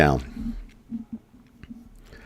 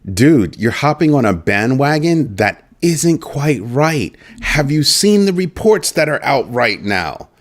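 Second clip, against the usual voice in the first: retort; 6 semitones higher, slower than usual. Retold or imitated. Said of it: "And I'm like".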